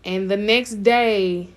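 normal talking voice

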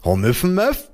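grinning mimicry. A narrator's reading. Child echoing muffled mother, grinning mimicry. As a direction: repeat it as a teasing question with a grin. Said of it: "wiederholte ich grinsend".

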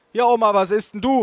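high, loud question; calling mother. A narrator's reading. Mother asking grandma's order, calling out. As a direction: call across to grandma. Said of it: "rief meine Mutter".

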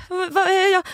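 high, stammering mock panic; panicked. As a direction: act out waking terror, stumbling on words. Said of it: "Min största jävla ångestmardröm".

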